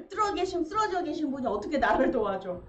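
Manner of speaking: usual speaking voice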